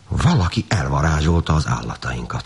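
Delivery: ordinary delivery, nothing marked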